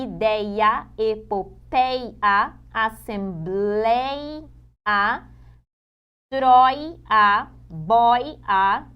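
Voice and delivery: very slow, syllable by syllable, pausing to isolate "ei" and "oi"; dividindo em sílabas. Shown as a demonstration. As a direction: stretch "ideia", "epopeia", "troia" into separate, drawn-out syllables. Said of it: "analise ali". (separando cada sílaba de "ideia", "jiboia", "heróico", bem devagar)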